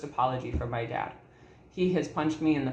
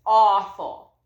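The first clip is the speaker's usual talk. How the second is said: short, loud repeat of Awful; loud emphatic Awful; anguished; 4 semitones higher, 9 dB louder than usual. Real, not acted. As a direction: punch the word Awful out hard. Recalling father's abuse.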